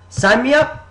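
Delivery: high, loud eager shout; hyped-up recruit signing on the spot; emphatic. Retold or imitated. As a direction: blurt it out in a burst of hype. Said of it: "you say emphatically".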